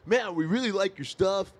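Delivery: lightly imitating a smooth TV executive; a hint of a laid-back industry dude, lifted a little; slick exec voice. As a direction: mimic the exec's easy schmoozing tone. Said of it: "he talked like that".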